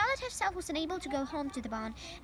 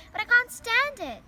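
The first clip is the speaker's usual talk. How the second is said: higher, louder anguished shout; 8 semitones higher, 8 dB louder than usual. A narrator's reading. Pig refusing to leave his dying friend, shouting. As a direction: shout it out in despair. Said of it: "shouted Wilbur".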